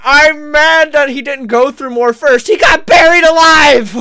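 high, loud, crying mock whine; mocking whiny impression. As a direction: whine it like a sore loser. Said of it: "You're like".

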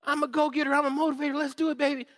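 high, bouncy mock go-getter; hyped go-getter. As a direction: play the overexcited motivator.

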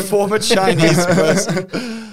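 laughing at a friend's joke; laughing echo. Real, not acted. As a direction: savour the joke through laughter.

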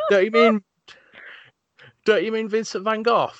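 high, loud know-it-all correction; course mate correcting. Retold or imitated. Girl on the course correcting classmates, imitated high. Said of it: "on our course just went".

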